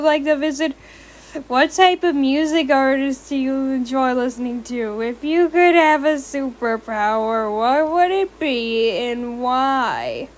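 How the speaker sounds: stiff robot voice reading questions. A narrator's reading. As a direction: recite it like a robot. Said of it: "you sound like a robot there".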